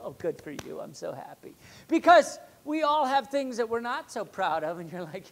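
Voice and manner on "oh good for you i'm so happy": low, soft, unimpressed sarcasm; sarcastic congratulations. Acted. To people so proud of themselves, low and sarcastic. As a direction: congratulate them without meaning a word, quietly.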